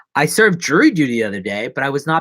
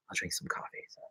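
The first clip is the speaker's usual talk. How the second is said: whisper; 7 semitones lower, 20 dB quieter, faster than usual. Real, not acted. Trailing aside.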